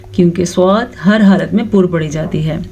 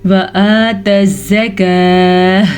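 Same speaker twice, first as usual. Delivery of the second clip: slow, drawn-out Quran recitation; tajweed recitation; much slower than usual. Shown as a demonstration. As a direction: chant it slowly, stretching the vowels. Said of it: "اس کو کھینچ کے پڑھنا ہے". (تجوید کے ساتھ آہستہ تلاوت)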